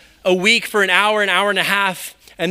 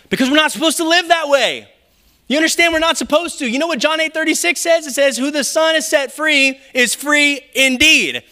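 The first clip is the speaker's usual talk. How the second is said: higher, fervent preaching push; preaching at full force; 7 semitones higher than usual. Acted. Pastor urging the congregation they are free, fervent preaching. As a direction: rouse the congregation toward freedom.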